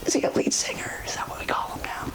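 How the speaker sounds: hushed, low questioning aside; whispered aside. Acted. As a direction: whisper it as a sly side comment. Whispered aside about [name].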